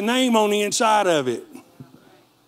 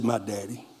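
much softer and lower, a hushed confident answer; hushed firm reply to the brothers; quiet conviction; 8 semitones lower, 9 dB quieter than usual. Retold or imitated. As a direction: lay it down softly and lower. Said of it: "answers brothers with quiet conviction".

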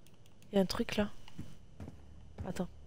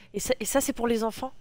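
normal talking voice

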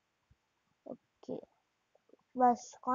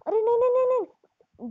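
high, loud rapid string of no's; real gamer panic, pitched up and loud; panicked protest; 9 semitones higher, 5 dB louder than usual. Real, not acted. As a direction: cry out in alarm as the game turns on you. In-game character about to die.

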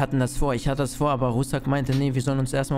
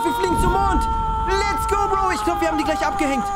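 high, loud cheering; euphoric shouting; 3 dB louder than usual. Acted. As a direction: whoop as the getaway works. Escape succeeding.

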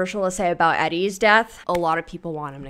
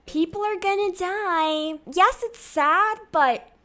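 high, slow airhead-style quote impression; 12 semitones higher, slower than usual. Imitating a celebrity's remark about dying, high-pitched mimicry. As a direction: draw it out high, lifting at the end. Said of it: "as Vanessa Hudgens said".

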